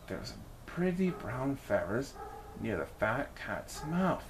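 plain reading voice

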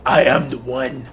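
low, slow growl as the Dark Knight; Batman voice. Pretend. Gamer doing Batman for scared teammates, low slow growl. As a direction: rasp it out like a brooding caped hero. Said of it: "Batman, hold me".

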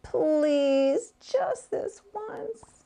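high, pleading and drawn out; begging mother. Acted. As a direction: plead sweetly with the child. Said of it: "tried begging him".

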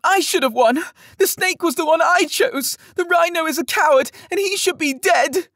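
put-on a spoiled boy stamping his feet; childish fit of anger. A narrator's reading. Young lord raging at the crowd, high tantrum. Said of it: "in a fit of anger".

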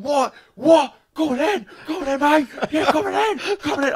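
high, loud mock yelling of a raging van man; aggressive shouting van driver. Retelling a raging van driver shouting at him in traffic, loud and high. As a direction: challenge him to fight, shouting. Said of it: "He sounds like a lunatic".

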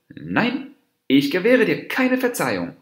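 high-pitched, indignant no; angry refusal. A narrator's reading. Dwarf deer denies the monkey forgiveness, angrily.

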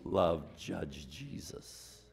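low, soft, very slow; quiet slow repeat after the climax; soft slow echo. Real, not acted. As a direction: hush it back to the people.

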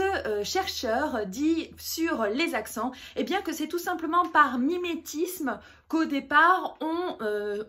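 normal talking voice

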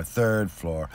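usual speaking voice

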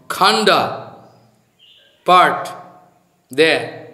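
ordinary talk, nothing marked

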